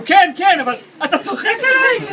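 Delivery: high, loud laughing imitation; mock squeal. Boyfriend imitating her disbelieving squeal, high and laughing. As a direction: mimic her disbelief playfully.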